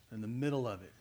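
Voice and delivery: usual speaking voice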